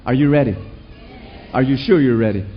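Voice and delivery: ordinary talk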